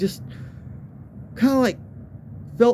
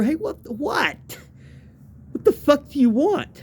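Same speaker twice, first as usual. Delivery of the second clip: lightly acted, higher-pitched irritated reply; retold annoyed friend; 7 semitones higher than usual. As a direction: answer like a friend rudely woken. Retold friends woken by yelling.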